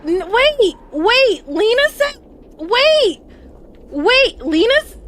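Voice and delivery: loud, high, stammered wait wait; real shock, shouting wait over and over; shocked yelling. Real, not acted. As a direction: blurt it out in disbelief. Shocked streamer reacting, shouting wait.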